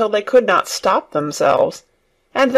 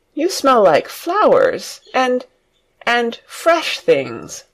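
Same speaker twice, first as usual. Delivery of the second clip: slow, much higher, delighted; joyous; 6 semitones higher, slower than usual. A narrator's reading. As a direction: breathe in the fresh smell with joy. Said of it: "he cried out quite joyously".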